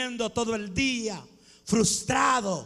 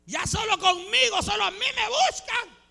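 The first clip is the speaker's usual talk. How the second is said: despairing protest; 9 semitones higher than usual. Acted. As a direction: cry out as if fed up with serving. Acts out despairing complaint to God. (queja desesperada, fingida, a gritos)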